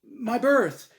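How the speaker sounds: loud, high desperate yell. Acted. Norman yells his last memory at the buzzer. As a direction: shout it out as the buzzer sounds. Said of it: "Norman yelled".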